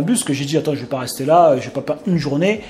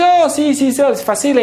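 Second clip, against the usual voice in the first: much higher, louder, breezy reassurance; imitating the driver; 10 semitones higher, 4 dB louder than usual. Uber driver insisting Spanish is easy, breezy and high. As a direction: play the driver brushing it off. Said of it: "Il dit".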